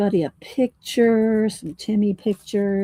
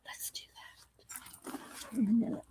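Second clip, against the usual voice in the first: whisper; 3 semitones higher, 16 dB quieter, slower than usual. Real, not acted. Aside to herself.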